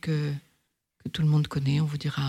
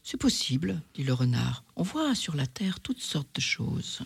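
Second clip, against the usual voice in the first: whispered; 5 dB quieter than usual. Acted. Fox answers in a hush.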